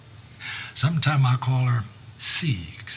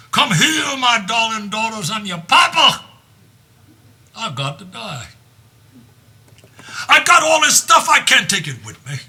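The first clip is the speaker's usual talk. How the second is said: high, loud summons; father shouting for his daughters; king calling out; 9 semitones higher, 5 dB louder than usual. Acted. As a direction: call the daughters in like a papa. Lear calls his daughters.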